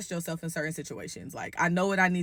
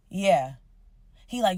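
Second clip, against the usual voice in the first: ordinary delivery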